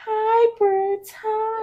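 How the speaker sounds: put-on very high little-kid voice; high, drawn-out toddler hello; imitating toddler greeting. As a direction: coo a greeting like a tiny child.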